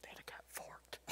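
laughing whisper under his breath; whispered aside. Real, not acted. Preacher whispering a joke to the congregation, laughing. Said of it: "whispered aside".

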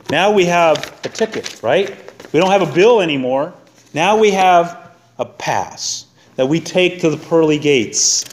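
ordinary talk, nothing marked

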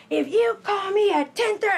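high, loud mock shout; retold yelling. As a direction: play the caller snapping at you. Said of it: "she's just cussed me out and yelled at me".

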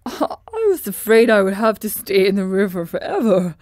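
happy floppy straw man; grateful Scarecrow. Acted. Scarecrow thanks the stork to his friends, in his own voice.

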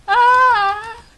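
long, loud, high cry; anguished wail. Real, not acted. Player mourns the shot leader, long anguished wail. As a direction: wail out in grief. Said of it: "I'm actually crying".

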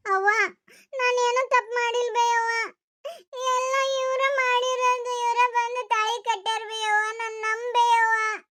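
high, slow, pleading through sobs; tearful begging. Acted. Daughter begging her mother, high and tearful.

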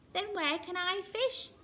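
plaintive question, pitched high; mildly forlorn Kingfisher; sad. A narrator's reading. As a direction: ask sadly, voice lifted. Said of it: "said Kingfisher sadly".

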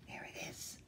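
a hushed whisper; whispered reveal. Real, not acted. Reader points out the bag to listening children, whispered. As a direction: let them in on a secret.

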